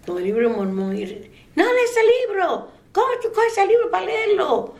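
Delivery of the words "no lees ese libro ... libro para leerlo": much higher, sharp scolding voice of the husband; stern father scolding. Retold or imitated. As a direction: snap at the boy to put the book down. Husband scolding boy over book.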